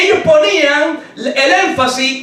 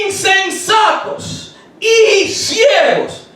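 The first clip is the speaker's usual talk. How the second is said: slow rebuke; 6 semitones higher, much slower than usual. Acted. Jesus rebukes.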